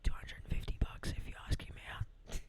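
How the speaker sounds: hushed background whisper; sneaky unvoiced whisper. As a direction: whisper it off to the side. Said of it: "Me in the background".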